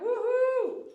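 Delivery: high, loud woohoo; cheer. Acted. Groomer cheering a donor on stream, loud whoop.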